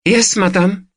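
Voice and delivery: high-pitched Eastern European woman; Eastern European accent. A narrator's reading. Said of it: "with an Eastern European accent".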